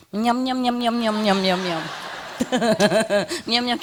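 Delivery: munch busily through the words like a bird. Retold or imitated. Imitates bird busy eating peanut.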